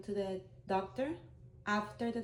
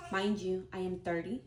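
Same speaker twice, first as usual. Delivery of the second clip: ordinary talk, nothing marked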